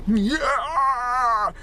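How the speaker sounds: loud, high ecstatic yell; ecstatic cry. Retold or imitated. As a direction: cry out in climax. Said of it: "It's like this".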